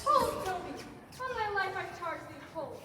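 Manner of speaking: commanding halt. Acted. Lady stops the fight.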